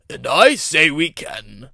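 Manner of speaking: loud, slow authoritative boom. A narrator's reading. Fat director breaking into the engines' talk, booming. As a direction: boom it out slowly. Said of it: "boomed a voice".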